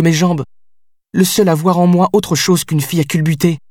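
high, heated and unrelenting; high heated outburst, listing what he means to her; outraged. Acted. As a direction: pour it all out at him, wounded. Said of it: "outrée par mes reproches".

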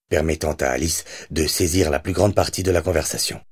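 plain reading voice

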